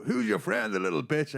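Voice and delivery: high, loud imitation of a heckler; mock taunt. Fighter asking about the friend who left, mock taunt. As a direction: jeer it, pitched well up.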